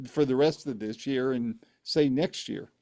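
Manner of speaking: ordinary delivery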